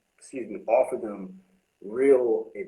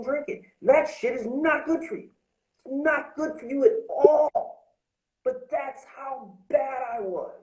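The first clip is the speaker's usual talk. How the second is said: high, intense confession; raw confession; 11 semitones higher than usual. Real, not acted. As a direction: pour it out, pitched up and pressing. Emotional confession raised high.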